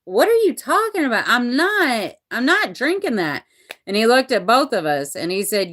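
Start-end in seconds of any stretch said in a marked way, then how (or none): 0.0-3.4 put-on high, disbelieving teen protest; incredulous teen son. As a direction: voice the son's baffled outrage, higher. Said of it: "And Rowan was like".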